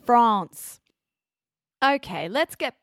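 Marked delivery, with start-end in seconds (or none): 0.1-0.8 France pushed loud with a long "ah"; emphatic French-vowel France; French-style "France". Shown as a demonstration. Mock correction to French vowel.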